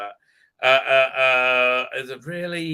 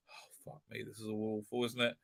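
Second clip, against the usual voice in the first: weary groan; 7 semitones lower, 14 dB quieter than usual. Real, not acted. Groaning at the show.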